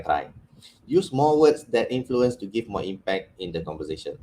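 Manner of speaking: ordinary delivery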